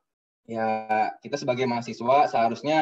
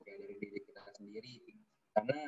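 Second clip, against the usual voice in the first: audio cutting out; broken-up call audio; choppy connection; 3 semitones higher, faster than usual. Real, not acted. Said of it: "suaranya putus-putus".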